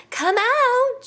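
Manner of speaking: high, loud bird call. Acted. Hornbill calling into the hollow tree, high and loud. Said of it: "she called".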